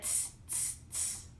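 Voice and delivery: soft, voiceless "tss" of mimicking monkeys; monkey tsking. A narrator's reading. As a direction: tsk back teasingly like monkeys. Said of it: "and said".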